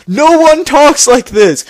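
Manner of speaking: high, loud and slow, disbelieving; exasperated outburst. Real, not acted. Ranting to viewers about the dialogue, loud and exasperated. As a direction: drag it out in exasperation.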